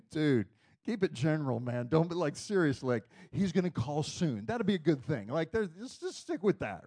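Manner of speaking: retold nervous coaching of a friend; mock-anxious pleading. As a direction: urge him to play it safe, half-joking. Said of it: "and I'm nervous. I'm over here going".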